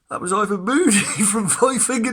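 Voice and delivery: loud, high, laughing through it; laughing reveal. Retold or imitated. Telling his mate who interviewed him, laughing loudly.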